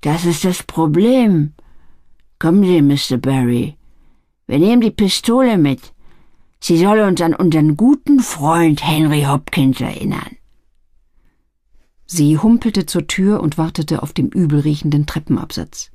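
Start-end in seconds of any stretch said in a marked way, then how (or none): 0.0-10.4 lightly voiced, measured menace; understated threatening matriarch; stern old boss woman. A narrator's reading. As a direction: give orders with a hint of cold authority.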